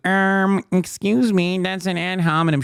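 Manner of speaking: put-on nerd correction; mock nerd voice. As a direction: imitate a pedantic nerd objecting. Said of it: "making a nerd voice".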